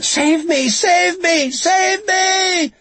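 very high, loud repeated screams; screaming for rescue. A narrator's reading. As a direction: scream frantically for help, over and over. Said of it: "She could still hear Lucy".

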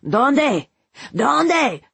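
high, urgent yell, pitch leaping and falling; frantic scream. A narrator's reading. Man demanding of his daughter where, screamed. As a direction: scream it out in panic, pitched well up. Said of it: "he screamed at his daughter".

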